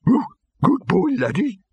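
calling a dog, high and hopeful; distant high calling voice. Acted. Troll in the rubble calls the dog, high. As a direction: call out to the dog in the dark.

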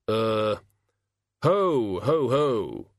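jolly Santa chuckle; a hearty ho ho ho. Acted. Festive figure laughs ho ho.

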